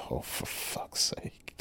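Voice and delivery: slow whispered groan of despair; acting out a hushed, weary curse; exasperated whisper. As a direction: mutter it under your breath, mortified. Embarrassed partner mutters to himself.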